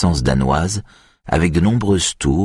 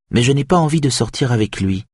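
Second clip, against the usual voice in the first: smiling; 8 semitones higher than usual. A narrator's reading. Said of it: "dit-elle en souriant".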